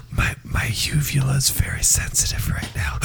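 breathy ASMR whisper. Pretend. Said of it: "in A S M R Reese language".

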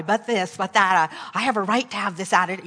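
loud, fast self-justifying protest; defensive excuses. Retold or imitated. Teacher justifying her impatience to herself, loud and defensive. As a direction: rattle off excuses to defend yourself.

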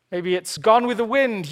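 normal talking voice

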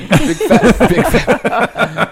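high laughing big fat; mock the staff's comment through laughter; laughing mimicry.

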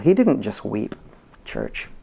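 normal talking voice

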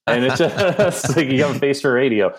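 amused, laugh breaking into the words. Real, not acted. Host joking about his face for radio, laughing.

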